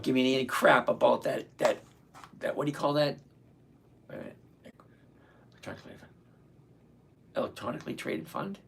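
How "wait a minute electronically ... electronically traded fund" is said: whispered, questioning to himself; soft unvoiced self-check; under his breath. Real, not acted. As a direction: whisper it as you double-check.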